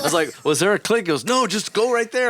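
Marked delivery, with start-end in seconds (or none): 0.4-1.0 high, puzzled question; confused question. Retold or imitated. Guitarist asking the director, confused and high. 1.2-2.3 high, breezy brush-off; mock director. Director telling guitarist to just come in, breezy.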